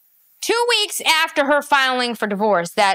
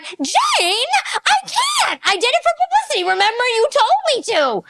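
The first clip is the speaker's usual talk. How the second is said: mock J Lo; 11 semitones higher than usual. Mocking [name] begging [name], high whiny shout. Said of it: "And she's like".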